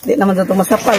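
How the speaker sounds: ordinary talk, nothing marked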